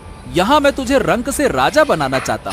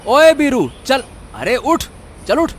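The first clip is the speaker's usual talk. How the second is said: rousing call; 2 semitones higher than usual. Acted. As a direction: call him briskly out of sleep. Owner rousing donkey in morning. (ज़ोर से जगाते हुए)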